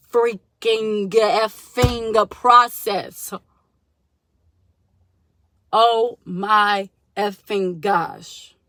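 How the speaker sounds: slow, loud, dragged-out exasperation; fed up. Real, not acted. Venting to viewers about lost ring, slow loud fuming. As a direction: drag every word out, fuming. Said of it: "I wanna curse so bad right now".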